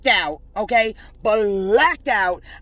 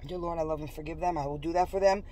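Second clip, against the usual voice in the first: quiet prayer; 7 semitones lower, 10 dB quieter, faster than usual. Acted. Praying aloud.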